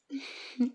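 high, happy little giggle; delighted giggle. Acted. Girlfriend delighted by her partner, happy giggle.

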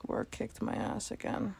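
whispered, worn out; tired whisper. Acted. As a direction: sigh it out in a whisper. Tired complaint after late night.

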